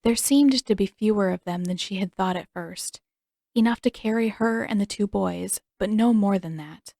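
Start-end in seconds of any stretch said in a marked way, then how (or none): none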